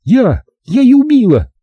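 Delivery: high-pitched triumphant confession; triumphant defiance. A narrator's reading. Zaza confesses to the killing, defiant and triumphant. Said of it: "метнула на меня торжествующий взгляд".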